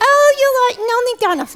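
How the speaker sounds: high, quick squeaky puppet voice; high-pitched childlike reaction; puppet character. Acted. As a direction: blurt it in a little puppet squeak. Puppet echoes host's correction.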